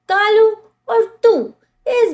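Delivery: sharp call across the room. Acted. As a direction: call his name out loud. Mother calling out to Kalu.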